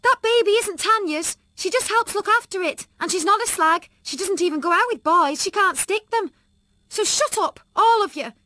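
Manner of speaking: high, loud tearful defiance; fierce. A narrator's reading. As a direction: stand up for her friend, near tears. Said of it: "I tried to sound fierce".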